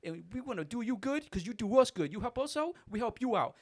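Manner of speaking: high, loud, fast friendly rant; Corey telling the clerk they buy double; gushing regular. Retold or imitated. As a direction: keep hyping him up. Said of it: "He goes".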